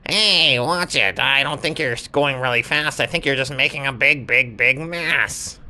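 a playful little huff at getting splashed; annoyed lizard. Acted.

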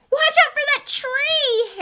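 high, loud, slow bellow. Acted. Harry bellowing a warning to Ron, alarmed. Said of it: "Harry bellowed".

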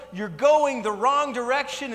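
high, loud, slow yell; mock roadside yell. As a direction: shout it across the road again. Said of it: "keep yelling and keep yelling".

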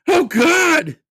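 very high, very loud squeal; disgusted squeal. Acted. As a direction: squeal in horror at the spit. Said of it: "he squealed".

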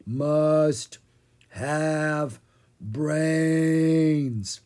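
slow, drawn-out zombie moan; zombie groan. A narrator's reading. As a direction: moan each word like the undead. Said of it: "Ella groaned".

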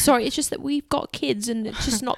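put-on British accent. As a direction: politely object as a British dad. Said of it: "sorry for my accent".

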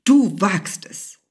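hissed menacingly, each word stretched; angry hiss. A narrator's reading. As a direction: draw it out slowly as a threat. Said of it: "zischte er".

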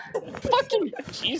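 shrieking laugh; laughing hard. Real, not acted. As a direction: burst out laughing. Caught by nuts joke.

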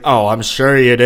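loud, mocking "sure you do"; sarcastic doubt. Woman in the car mocking his cowboy hat claim, sarcastic and loud. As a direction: scoff at his claim like she doesn't buy it. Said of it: "And she's like".

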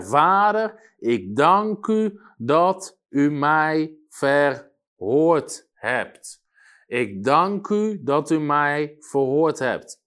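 loud, slow declaimed Bible verse; solemn scripture reading. A narrator's reading. Preacher reads Jesus's prayer to the listeners, solemn proclamation. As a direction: proclaim each word with weight and drawn-out pauses.

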